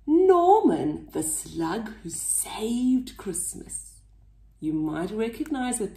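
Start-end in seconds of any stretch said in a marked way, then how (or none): none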